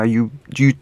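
usual speaking voice

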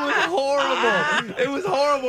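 high, slow laughing through words. Real, not acted. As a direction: laugh helplessly at the memory. Laughing recalling embarrassment.

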